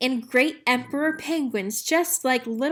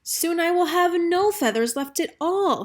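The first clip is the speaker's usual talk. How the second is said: peeped sadly; 7 semitones higher than usual. A narrator's reading. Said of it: "he peeped sadly".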